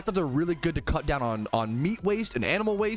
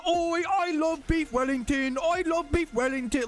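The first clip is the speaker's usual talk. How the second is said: high, stretched-out British caricature; mock British; 9 semitones higher than usual. Showing Brits what to rave about, mock British accent. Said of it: "You should be going".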